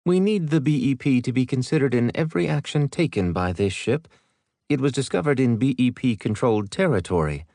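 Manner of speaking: lightly voiced stern commodore; female commodore voice.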